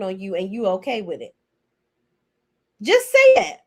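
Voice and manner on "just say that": high, loud challenge; shrill demand. Acted. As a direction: throw it at her, daring her to say it. Confronting Kimmy, demanding admission.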